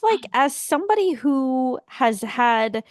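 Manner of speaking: ordinary delivery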